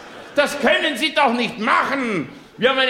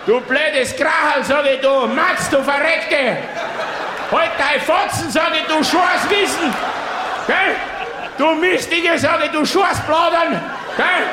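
furious rant; 5 semitones higher than usual. Acted. Tennisvater beschimpft Mutter lautstark.

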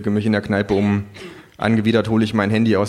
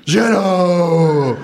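kangaroo calling out; 9 semitones higher, 5 dB louder than usual. A narrator's reading. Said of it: "ruft das Känguru".

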